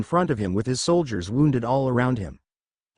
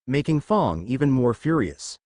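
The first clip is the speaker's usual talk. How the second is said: plain reading voice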